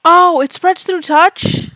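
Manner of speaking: loud, slow shocked cry. Real, not acted. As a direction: blurt it out in alarm. Player learns of contagion.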